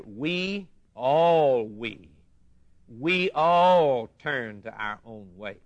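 WE and ALL drawn out and punched; stress on key words. Shown as a demonstration. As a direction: lean long and hard on "all". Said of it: "listen to some, some words here".

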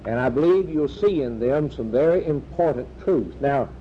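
ordinary talk

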